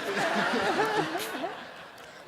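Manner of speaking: high loud laughing fit; big laugh. Real, not acted. Laughing at his own story to the audience, a big high laugh. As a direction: let the laugh ring out.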